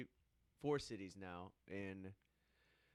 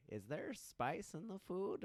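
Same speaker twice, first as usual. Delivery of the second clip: put-on high, slow old-lady question; imitated old lady; 7 semitones higher, 4 dB quieter, slower than usual. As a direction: ask slowly, pitched up like an old customer. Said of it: "old lady come in, and she's like".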